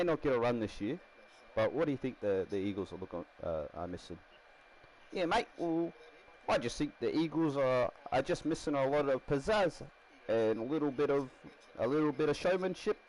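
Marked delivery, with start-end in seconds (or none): 5.1-13.0 higher-pitched Kiwi player voice; Kiwi accent. Acted. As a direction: answer in a put-on Kiwi accent. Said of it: "I don't know why I'm Kiwi".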